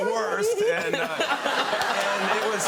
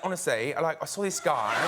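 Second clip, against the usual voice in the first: Towie-style Essex accent; 2 semitones lower than usual. Shown as a demonstration. American actor parroting Towie for a British audience, Essex accent. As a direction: put on an Essex reality-show accent. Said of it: "do their accent a little bit".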